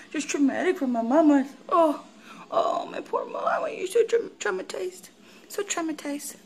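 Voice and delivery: very high, breathy pity voice for the dog. Real, not acted. Pitying the dog in baby talk.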